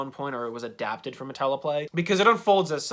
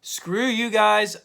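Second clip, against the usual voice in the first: raised, louder, drawn-out retort; mock defiant outburst, stretched out; finally snapping; 7 semitones higher, 5 dB louder, much slower than usual. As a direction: imitate someone who has had enough. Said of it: "eventually he finally snaps".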